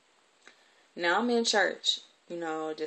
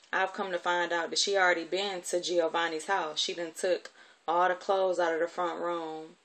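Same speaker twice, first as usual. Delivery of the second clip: ordinary delivery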